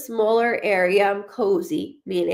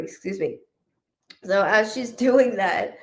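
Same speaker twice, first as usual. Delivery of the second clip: usual speaking voice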